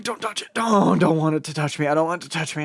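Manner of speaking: loud, frantic self-talk; freaking out. Retold or imitated. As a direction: stammer it out in a fluster. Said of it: "freaking out a little bit".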